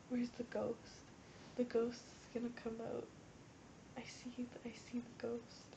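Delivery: hushed, fading into a whisper; mock ghost-hunter whisper. Vlogger mimicking paranormal activity, mock hushed whisper. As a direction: play-act a spooked ghost hunter, very softly.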